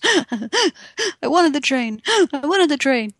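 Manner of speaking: put-on high, slow, huffing child through tears; high, drawn-out toddler whine between crying breaths. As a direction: imitate a sobbing three-year-old. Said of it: "huffingly through crying breaths goes".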